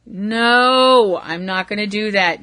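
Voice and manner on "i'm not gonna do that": ordinary talk, nothing marked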